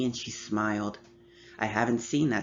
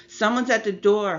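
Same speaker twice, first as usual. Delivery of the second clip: shout; 9 semitones higher, 7 dB louder than usual. Acted. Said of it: "Holly screamed".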